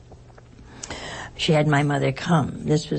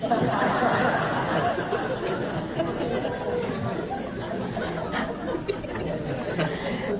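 long laugh; 13 semitones higher, 4 dB quieter than usual. Real, not acted. Remembering she disliked nuns.

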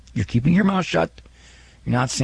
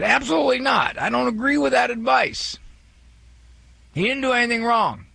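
much higher-pitched retold objection; indignant caller; 11 semitones higher than usual. Host quoting a caller who disagrees with his advice, indignant. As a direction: imitate a caller flatly refusing the advice, voice pitched right up. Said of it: "Stephen called and said".

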